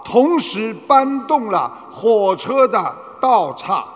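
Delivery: ordinary delivery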